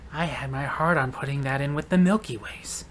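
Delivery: low, quiet and sulky, mocking a shopper; sulky customer mock. Cashier mocks the customer's dirty look, sulky low mutter. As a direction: sulk it under your breath like a huffy shopper. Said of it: "accompanied with a dirty look, like".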